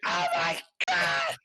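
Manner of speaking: startled shriek. Retold or imitated. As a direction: shriek it as the groundhog stares back. Gym member finds groundhog.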